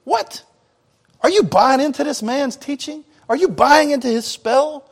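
high, incredulous mock outrage; livid Pharisees. Pharisees scolding their officers in mock outrage. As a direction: sneer in disbelief at the officers. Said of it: "They're livid".

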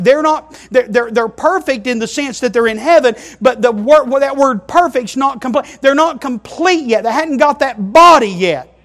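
high, loud, insistent pulpit voice; impassioned preacher correcting himself at volume; full-force preaching. Real, not acted. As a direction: drive the point home to the whole church.

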